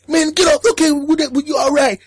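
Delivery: mock phone yell; acted yelling. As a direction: bark it down the line.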